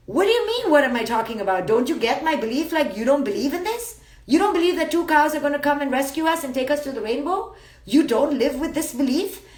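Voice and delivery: indignant comeback, opening very high; mock indignation. Believer challenging the doubter about cows, mock indignant. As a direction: play the affronted believer, firing questions back.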